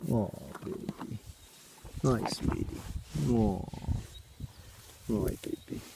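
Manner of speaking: hushed, soft cooing. Real, not acted. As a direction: whisper sweetly to the horse. Cooing to horse.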